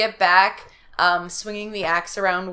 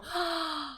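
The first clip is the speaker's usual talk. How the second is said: soft gasp; 10 semitones higher, 9 dB quieter than usual. Acted. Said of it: "She screamed".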